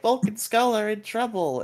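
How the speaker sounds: high-pitched mock alarm; high, urgent Alpha imitation. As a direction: raise the pitch sharply, like a fretting sidekick. Voicing Alpha in the recap.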